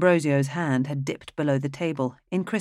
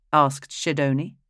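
ordinary delivery

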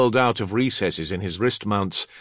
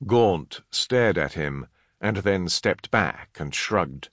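ordinary delivery

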